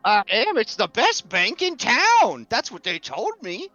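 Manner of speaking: drawn-out, swooping, naive enthusiasm; proud, simple Gus. Acted. Customer praising his bank, proud and naive. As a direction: stretch the words with simple pride. Game master plays Gus.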